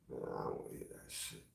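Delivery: fast whispered brush-off; dismissive whisper. Retold or imitated. Man brushing off a woman's words, a fast whisper. Dismissing a compliment under his breath.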